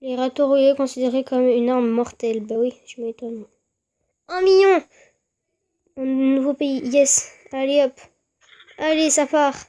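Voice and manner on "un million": excited. Real, not acted. Reacting to infection count.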